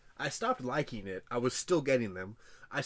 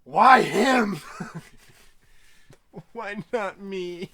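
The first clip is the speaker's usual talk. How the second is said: hammed-up wail of jealousy, louder and higher; mock anguished outcry; 8 semitones higher, 14 dB louder, much slower than usual. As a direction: cry out to the sky like a jilted fan, stretching the last line slowly. Mock despair over celebrity couple.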